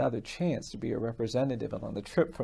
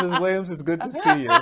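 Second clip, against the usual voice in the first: laughing through the greeting, higher and louder; 6 semitones higher, 9 dB louder than usual. Real, not acted. Lawyer greeting his client in court, laughing. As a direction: let a real laugh spill into the words.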